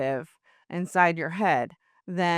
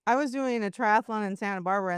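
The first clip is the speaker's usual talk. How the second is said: normal talking voice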